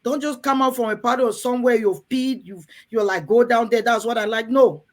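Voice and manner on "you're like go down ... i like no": usual speaking voice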